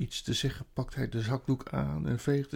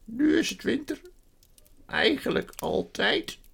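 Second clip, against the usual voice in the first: mournful faun; 11 semitones higher, 5 dB louder than usual. A narrator's reading. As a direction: draw it out high and sorrowful. Voices the faun in the story.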